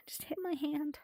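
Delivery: whispered aside. Real, not acted. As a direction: whisper under the breath. After hitting hand.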